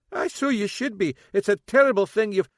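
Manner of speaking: loud high scolding; angry dad scolding; scolding father. A narrator's reading. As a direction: tell her off hotly.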